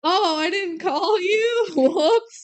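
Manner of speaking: sheepish mock whine. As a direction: whimper out a sheepish excuse, then a goofy "whoopsie". Mesmer caught out, acted quote.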